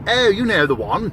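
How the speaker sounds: a light sniffy home counties lilt; just a hint of a posh home counties caller; mock home counties accent. Lightly mocking a home counties accent to the listener. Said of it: "put on home counties accent".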